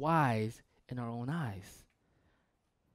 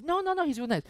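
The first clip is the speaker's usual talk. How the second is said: much higher, protesting; high insistent girl; 12 semitones higher, 3 dB louder, faster than usual. Retold or imitated. Girl insisting he's nice in a high voice. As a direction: squeak out the defence. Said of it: "It's like".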